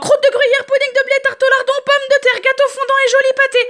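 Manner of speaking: fast, high, giggly jumble; all talking at once. Acted. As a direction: rattle off the menu, excited. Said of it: "ils parlent tous en même temps".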